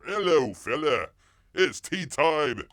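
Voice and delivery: loud, high hammed-up British; mock Cockney accent. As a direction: bellow a fake Cockney greeting. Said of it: "best Cockney English".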